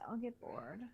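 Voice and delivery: ordinary delivery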